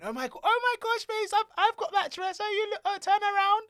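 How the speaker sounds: very high, loud mock-girly voice; gushing women. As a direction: imitate excited women fawning over each other. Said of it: "Many times you go past the toilet".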